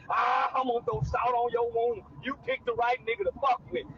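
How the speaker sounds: usual speaking voice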